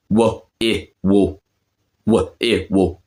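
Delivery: "will" broken into slow pieces, "wa, I, wo"; Cockney pronunciation. Shown as a demonstration. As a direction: sound out "wa, I, wo" with no L. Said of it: "how they say it in Cockney".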